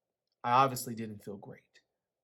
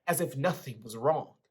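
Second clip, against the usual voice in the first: usual speaking voice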